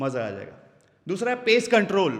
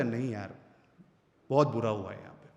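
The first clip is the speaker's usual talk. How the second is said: much lower, softer; low sad demo; 10 semitones lower, 6 dB quieter than usual. Shown as a demonstration. Speaker demos pitch down for bad news, low and soft. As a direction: drop the pitch, sound let down. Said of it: "अगर आप pitch down करोगे".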